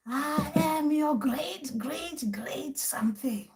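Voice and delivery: slow, drawn-out, high ancestral spirit voice; otherworldly elder, very slow; manifesting ancestor. Acted. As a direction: stretch it out like an ancient relative arriving. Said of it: "ancestors manifesting".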